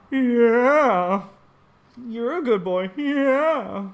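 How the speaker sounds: loud, high, strained comic croak; Neil Hamburger voice. Retold or imitated. Owner praising his dog in Neil Hamburger's voice. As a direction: blast the yeah like a sour old comic. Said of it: "impersonation of Neil Hamburger".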